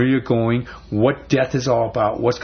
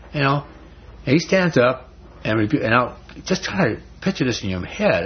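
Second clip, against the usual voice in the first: ordinary talk, nothing marked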